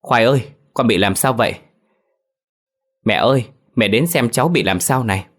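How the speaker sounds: put-on mother cries out over her dead baby; wailing cry. A narrator's reading. Mother wailing over her son to grandmother, anguished cry. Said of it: "gào lên".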